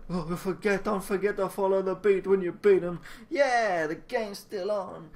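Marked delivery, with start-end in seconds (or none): none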